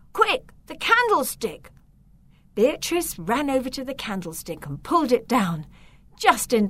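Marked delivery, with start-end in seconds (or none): 0.1-1.7 put-on high, urgent boy's call; urgent shout. Victor urges Beatrice to grab the candlestick, high urgent shout. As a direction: yell it to warn Beatrice in a hurry. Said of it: "Victor shouted".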